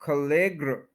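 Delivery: खलेग्र as kuh-LEG-ruh; schwa after stress. Shown as a demonstration. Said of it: "उसके बाद श्वा sound है".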